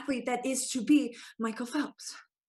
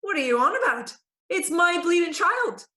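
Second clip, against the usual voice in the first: put-on thick Dublin accent, outraged at the priest; furious Dublin mammy shouting; angry Dublin mother; 7 semitones higher, 5 dB louder than usual. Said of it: "She's still not happy".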